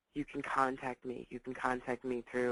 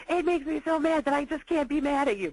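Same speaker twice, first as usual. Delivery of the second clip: put-on much higher, louder exasperated teen voice; frustrated daughter; 11 semitones higher, 6 dB louder than usual. Daughter to her mother, half-laughing frustration. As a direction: vent the fake fury of a kid who can't stay angry. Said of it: "she was like".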